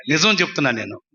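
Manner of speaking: ordinary talk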